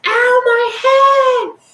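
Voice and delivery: very high, loud mock shriek; hammed-up high-pitched wail; imitated screaming. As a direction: screech it slowly, mimicking an outraged girl. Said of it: "she starts screaming".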